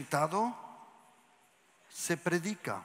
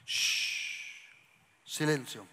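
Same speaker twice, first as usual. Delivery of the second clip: quiet hush; 6 semitones lower, 5 dB quieter, much slower than usual. Shown as a demonstration. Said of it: "Cuando uno tiene autoridad, dice".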